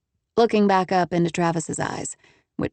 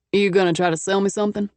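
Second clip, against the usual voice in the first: put-on heavy Texas accent; faster than usual. A narrator's reading. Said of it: "He had a heavy Texas accent".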